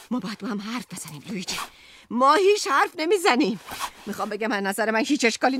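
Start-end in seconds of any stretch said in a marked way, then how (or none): 0.1-1.6 softer, quick, pleading under the breath; quiet plea. Acted. Wife quietly begging Luigi to talk, soft and quick. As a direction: say it low and fast, almost to herself.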